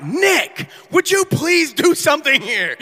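high pleading call to Nick; pleading with a friend to call off the dealers; pleading plea for help. Retold or imitated. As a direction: beg him to step in.

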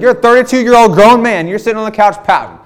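high, loud mocking wife voice; hammed-up mimic of a teasing wife. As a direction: squeal it like a scolding wife. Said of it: "She's like".

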